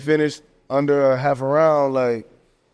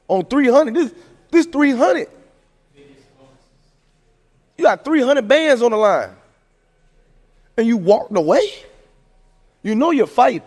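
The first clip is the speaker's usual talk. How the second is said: incredulous outrage; 8 semitones higher than usual. Real, not acted. Outraged about the missed bonus.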